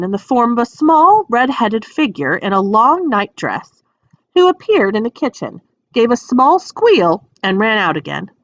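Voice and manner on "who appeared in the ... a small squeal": usual speaking voice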